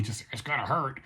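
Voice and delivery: usual speaking voice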